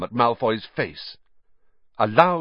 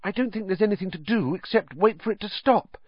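put-on higher, worried girl's voice; a mild, higher touch of worry; anxiously; 11 semitones higher than usual. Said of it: "said Hermione anxiously".

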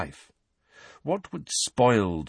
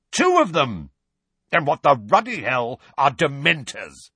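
angry; 7 semitones higher, 5 dB louder than usual. A narrator's reading. Vernon shouting in anger.

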